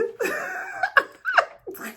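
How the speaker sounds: high-pitched laughing fit; giggling fit. Real, not acted. Teacher cracking up at her own lesson, giggling fit. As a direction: burst into helpless high giggles.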